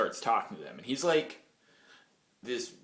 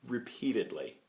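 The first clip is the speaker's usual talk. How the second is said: ordinary talk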